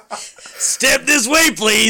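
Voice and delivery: slow laughing mock secretary. Host copies the secretary's line, slow and laughing. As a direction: drawl it out, barely holding the laugh. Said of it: "exact same voice".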